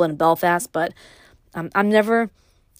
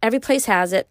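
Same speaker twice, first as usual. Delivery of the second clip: ordinary delivery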